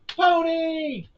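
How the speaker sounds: loud silly cry of pony; goofy shout. Pretend. Gamer ending his pony song with a loud goofy shout. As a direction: belt out the word like a punchline.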